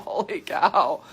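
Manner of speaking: normal talking voice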